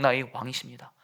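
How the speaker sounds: ordinary talk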